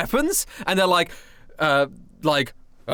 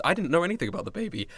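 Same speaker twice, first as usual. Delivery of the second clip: blurted fast; 3 semitones lower, 7 dB quieter, faster than usual. Acted. Healed guard blurts out at once.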